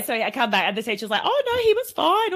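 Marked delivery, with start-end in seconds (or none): 1.2-2.3 high, loud teacher voice; imitated breezy teacher. Teacher reassuring mum at pick-up, imitated high breezy voice. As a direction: chirp it like an unbothered teacher.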